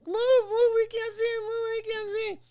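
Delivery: piercing, very high mock complainer; nasty, ugly voice. Spectator complaining at a pacing fighter, nasty high voice. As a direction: caricature an annoying woman, high and insistent. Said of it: "like a nasty, ugly, ugly voice".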